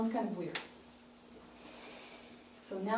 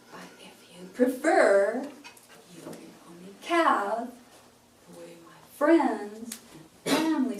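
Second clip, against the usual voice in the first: slow, louder toward the end of each chunk; slow build to a big word; 8 dB louder than usual. Shown as a demonstration. Coach showing small words building to big ones. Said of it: "my voice is gonna go".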